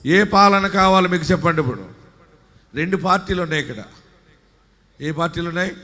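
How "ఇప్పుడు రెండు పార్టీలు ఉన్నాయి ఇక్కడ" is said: ordinary talk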